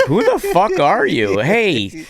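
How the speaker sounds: mock heckle. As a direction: tear into him from the stage. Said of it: "I'm like".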